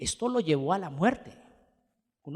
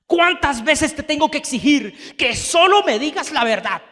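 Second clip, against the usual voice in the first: loud, higher, angry demand; harsh rebuke; 8 semitones higher, 11 dB louder than usual. Retold or imitated. King Acab harshly rebuking Micaías, angry and loud. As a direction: snap at the prophet in royal rage. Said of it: "le respondió con dureza".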